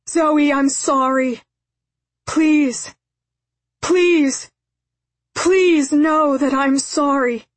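high, loud, desperate apology; screaming plea. A narrator's reading. Father apologises to daughter up the hill, screaming. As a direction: cry out to her, begging to be believed. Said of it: "again he began screaming".

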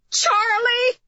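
high-pitched mother's call; yelled. A narrator's reading. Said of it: "yelled Missus Lanowitz".

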